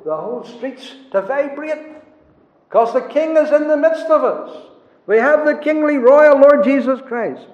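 high, swelling, full-force proclamation; exultant preaching. Real, not acted. Preacher proclaiming the king among the congregation, exultant preaching. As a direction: build to a loud, high cry of triumph.

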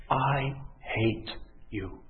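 slow, hushed, word by word; quiet, grave confession. Acted. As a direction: let each word drop softly and heavily. Same words now said to God.

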